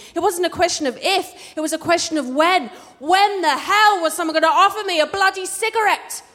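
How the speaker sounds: high-pitched, building exasperated eagerness; quick start, rising to an indignant demand; mock impatience. Storyteller recalling wait to start smoking, comically impatient.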